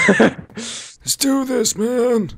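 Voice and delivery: high, loud, drawn-out rally; hyped-up battle cry. Pretend. Player psyching up his partner, high battle cry. Hyping up before action.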